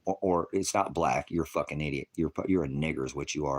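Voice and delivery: ordinary talk, nothing marked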